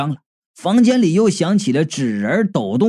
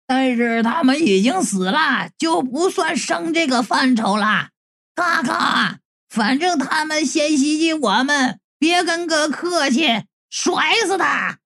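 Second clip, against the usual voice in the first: high-pitched, held-back holler; calling out; 7 semitones higher, 3 dB quieter than usual. A narrator's reading. Narrator urging the simpleton on against ghosts, high-pitched calling. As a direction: call through cupped hands, pitched way up. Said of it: "朝雷迪嘎嘎就喊".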